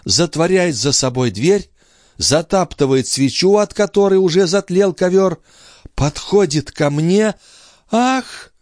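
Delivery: high voice rising to a gasp; mounting high-pitched dread; entranced sister, alarmed. Acted. Sister relives the intruder coming towards her, high and alarmed.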